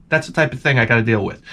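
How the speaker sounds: ordinary talk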